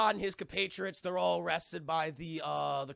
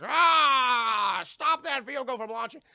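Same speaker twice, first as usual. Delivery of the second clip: high, loud barked order; loud commanding shout; 7 semitones higher, 11 dB louder, slower than usual. Retold or imitated. Soldier shouting to stop the vehicle launch, loud commanding yell. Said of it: "he's like".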